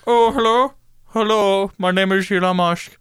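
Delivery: slurred, goofy mock-accent impression; silly foreign-accented hello; Elon Musk. Shown as a demonstration. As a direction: show off the billionaire caricature properly. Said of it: "it's more like this".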